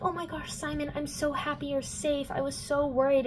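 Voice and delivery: high, softened mock gushing; relieved gushing. Clary to Simon back in human form, relieved gushing. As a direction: imitate a girl gushing with relief.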